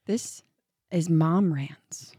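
breathy slow whisper of the show name; hushed show title whisper; whispered intro. Acted. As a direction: whisper it like a secret.

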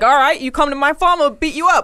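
high, loud hammed-up threat; mock tough guy threat. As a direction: bark it like a cartoon brute. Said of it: "He's not like".

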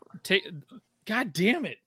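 words broken by hiccups. Real, not acted. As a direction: cut off by a hiccup. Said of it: "goddamn hiccups".

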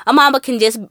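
ordinary delivery

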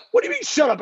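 high-pitched, incredulous retort; mock-outraged challenge; retold indignant comeback. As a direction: throw the words back, acting out an argument. Retold argument, snapping back at Teddy.

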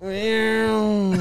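high, drawn-out descending-body sound effect; imitated sliding noise. Describing the knocked-out guy dragged down, silly sliding noise. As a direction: draw out a silly high wail. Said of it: "and the guy goes".

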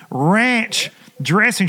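Ranch dressing stretched out slowly; slow emphatic repeat. Retold or imitated. Retelling a wing order to a confused waitress, slowly and deliberately. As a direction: drag out every syllable of Ranch dressing. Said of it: "And I said".

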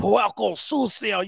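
slow, emphatic Spanish insult; Puerto Rican Spanish. Retold or imitated. Imitating his ex-wife scolding him in slow, emphatic Spanish. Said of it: "I did it in, like I was Spanish".

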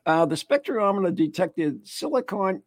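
normal talking voice

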